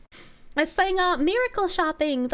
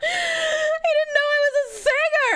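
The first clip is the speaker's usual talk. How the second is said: laughing shout; 13 semitones higher than usual. Real, not acted. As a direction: burst out through laughter. Laughing after loud bit.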